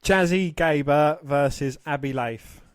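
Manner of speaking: ordinary delivery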